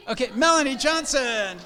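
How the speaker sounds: high, loud call of a name. Real, not acted. Host calling Melanie Johnson up, loud and high. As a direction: announce her name across the room.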